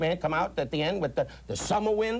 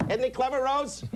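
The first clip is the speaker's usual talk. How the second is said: gushing fan; 9 semitones higher than usual. Retold or imitated. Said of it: "they all go".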